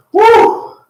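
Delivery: high whooping cheer; excited whoop. Real, not acted. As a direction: let out a big whoop. Coach cheers.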